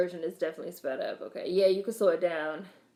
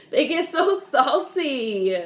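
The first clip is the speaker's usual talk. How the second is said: louder and slower, laughing through the words; 6 semitones higher, 7 dB louder, slower than usual. Real, not acted. Still laughing about salty grandparents, the words pushed out through laughter. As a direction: get it out while still cracking up.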